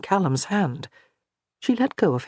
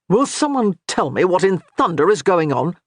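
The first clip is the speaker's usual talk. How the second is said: impatient demand, a bit louder; 3 semitones higher, 4 dB louder than usual. A narrator's reading. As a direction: Nicholas demands answers from the table, exasperated. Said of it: "Nicholas demanded".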